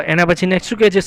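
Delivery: ordinary talk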